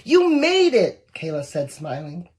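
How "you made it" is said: high, loud and slow squeal of joy; delighted squeal. Acted. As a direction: squeal it out, overjoyed. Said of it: "squealed with delight".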